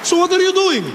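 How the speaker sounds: put-on high-pitched accented objection, halting at first; exasperated passenger. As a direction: argue back in a put-on Middle Eastern accent. Acts exasperated passenger arguing back.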